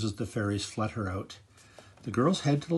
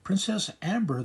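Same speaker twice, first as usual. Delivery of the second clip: calling out; 8 semitones higher, 5 dB louder than usual. A narrator's reading. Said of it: "the librarian calls out".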